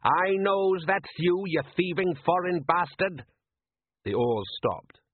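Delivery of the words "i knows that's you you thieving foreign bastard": higher, slow furious fisherman's yell; angry fisherman. Acted. Solid yelling at the rival fisherman, angry. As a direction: roar at the rival across the water.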